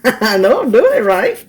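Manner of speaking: said through a laugh. Real, not acted. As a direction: laugh while insisting.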